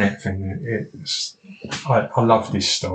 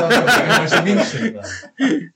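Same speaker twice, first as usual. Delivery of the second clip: laughing; 10 semitones higher than usual. Real, not acted. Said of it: "I said (laughing)".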